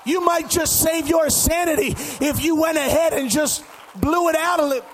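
full-force preaching shout. Real, not acted. Preacher urging the congregation to let it out, shouting. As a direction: shout it out to a whole church. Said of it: "I'm shouting to keep from going crazy".

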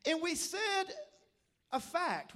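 ordinary talk

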